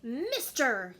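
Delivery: a loud call of Mister, delivered like a sneeze; sneezy Mister. A narrator's reading. The Lorax hailing the Onceler, loud and sneezy. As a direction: bark out Mister as if sneezing sawdust. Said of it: "he said with a sawdusty sneeze".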